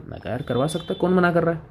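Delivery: normal talking voice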